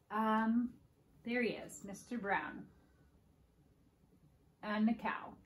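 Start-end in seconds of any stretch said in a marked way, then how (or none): none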